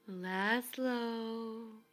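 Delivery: creaky voice that seems very far away; the dark calls Laszlo at night, creaky and distant. A narrator's reading. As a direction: call his name from far off, creaky and cold. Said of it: "voice of the dark was as creaky".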